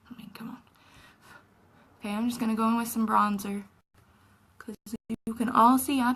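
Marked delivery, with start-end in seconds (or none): 0.1-0.6 softly whispered, almost under the breath; whispered aside. Real, not acted. To viewers about playing with makeup, a whispered aside. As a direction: drop to a whisper for a playful aside.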